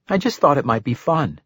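put-on a little girl hoping to persuade; young girl voice. Girl explains.

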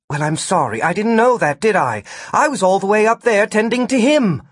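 indignant self-defence; defensive retort. A narrator's reading. Gwen argues back defensively.

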